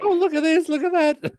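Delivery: very high, giddy imitation of excitement; hammed-up squeal of someone who can't play it cool; mock freak-out. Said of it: "when you're freaking out going".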